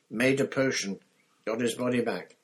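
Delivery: a lightly voiced teenage boy pushing the words out; shaken boy. Harry tells Moody Cedric was killed, urgent and shaken.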